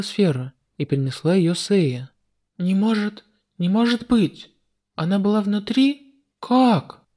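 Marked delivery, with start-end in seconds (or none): 2.6-7.0 higher stunned villain; shocked disbelief. Acted. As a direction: gasp it out in disbelief. Demon shocked. (потрясённый злодей)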